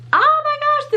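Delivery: high thrilled oh my gosh; delighted gasp. Real, not acted. Seeing a tiny mouse charm, high delighted exclaim. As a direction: squeal it with delight.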